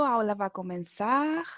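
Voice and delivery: normal talking voice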